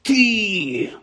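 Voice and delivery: high, drawn-out angry hiss; furious hiss. A narrator's reading. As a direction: hiss it in outrage at an intruder. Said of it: "люто засичав Рон".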